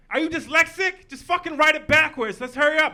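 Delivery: retold classmates yelling at the boy at the blackboard; impatient classmates. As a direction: bark it like impatient kids rushing you. Classmates mocking him at blackboard.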